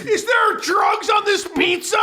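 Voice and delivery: high, slow yelled counselor question; hammed-up accusing staff member; mock counselor. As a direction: play the suspicious counselor with a high, drawn-out yell. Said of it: "he's like".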